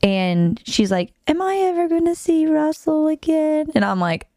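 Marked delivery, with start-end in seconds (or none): 1.3-3.7 imitating little niece. Niece asking about the ex, imitated child voice. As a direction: plead like a four-year-old. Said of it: "my little niece at the time is, like, four".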